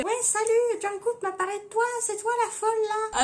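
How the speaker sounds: high-pitched put-on voice; high, playful character impression. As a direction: greet her in a silly high voice. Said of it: "Avec une voix comme ça".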